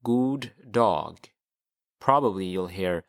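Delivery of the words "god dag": God dog said as "goo dahg"; teacher combines the two words for learners; Swedish god dag. Shown as a demonstration. Teacher models god dag to the class. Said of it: "you pronounce that god".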